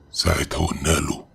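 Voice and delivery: plain reading voice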